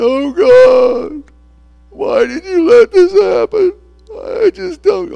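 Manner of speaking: high, loud mock crying; mock wailing. Believer in heaven wailing to God, mock crying. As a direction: wail at God, hammed-up sobbing. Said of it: "we're gonna be standing there".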